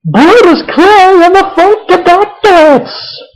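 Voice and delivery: sarcastic mock announcement of a goofy act; mock ring announcer. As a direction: belt it out like a cheesy ring announcer. Mocking the ring entrance.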